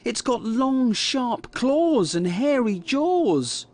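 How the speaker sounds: high, slowly drawn-out scary description; feigned dread. A narrator's reading. Kipper describing his pretend monster, in feigned dread. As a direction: stretch it out high to spook Mum.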